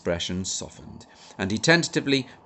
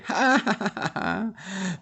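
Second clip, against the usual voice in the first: roar of laughter; 13 semitones higher, slower than usual. A narrator's reading. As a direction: burst out laughing. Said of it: "a roar of laughter".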